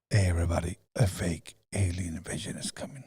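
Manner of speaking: very low, softened mock warning; ominous announcement. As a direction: drop low and hush the warning. Reprises the ominous announcement as sign-off.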